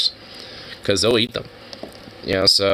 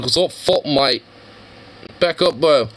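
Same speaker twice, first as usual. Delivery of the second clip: higher, cocky mate voice; tough little critter; 5 semitones higher than usual. Retold or imitated. Critter telling the other to back up, cocky higher voice. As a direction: square up like a cheeky little bloke. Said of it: "It's like".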